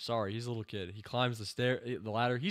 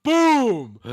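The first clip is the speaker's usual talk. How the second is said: sudden high, loud "Boom!"; a gleeful shout at the screen; excited shout; 13 semitones higher, 19 dB louder than usual. Real, not acted. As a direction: yell it out in delight. Cheering a tackle on screen.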